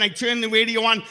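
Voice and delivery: ordinary talk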